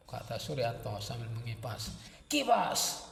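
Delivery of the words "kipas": high-pitched one-word command; barked order. A narrator's reading. Jelitang ordering Suryanto to fan, high command. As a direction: order a servant to get on with it.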